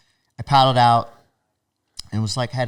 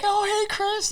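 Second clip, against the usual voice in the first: very high hailing voice; imitated friendly greeting. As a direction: call out a hello, pitched way up. Acquaintance greeting him outside store.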